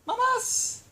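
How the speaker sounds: high, bright call to the animals; calling the pets. Real, not acted. Calling her pets home in a high voice. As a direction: sing out the pets' pet name from across the room.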